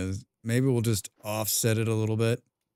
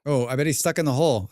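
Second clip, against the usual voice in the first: ordinary talk, nothing marked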